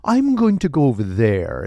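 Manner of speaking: theatrical, swooping celebrity scientist impression; Neil deGrasse Tyson. Scientist doubts the host's theory, amused lofty impression. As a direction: talk down to them with grand emphasis. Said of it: "how do you like this impression?".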